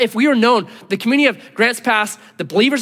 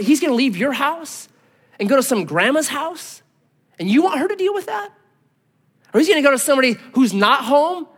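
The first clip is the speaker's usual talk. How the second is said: scolding friend; 6 semitones higher than usual. Retold or imitated. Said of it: "He's like".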